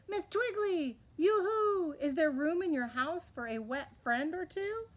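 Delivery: high, singsong yoo-hoo call; pleading high call to the tree house. A narrator's reading. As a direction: call up hopefully from the flood. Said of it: "called the mayor".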